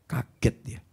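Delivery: normal talking voice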